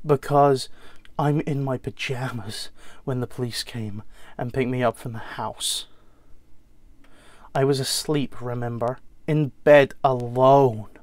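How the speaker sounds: wounded American son telling his story, with heavy pauses; American accent, accusing then reliving a childhood night. Acted. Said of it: "James will be in an, an American accent".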